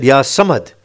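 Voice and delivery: ordinary talk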